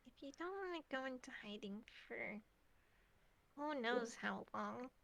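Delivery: hushed, near-whispered pondering in character; soft and hesitant, working out an idea; thinking it over. Acted. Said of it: "Fleck is thinking this".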